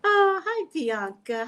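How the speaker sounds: high, loud excited hi; light up greeting a viewer. Real, not acted.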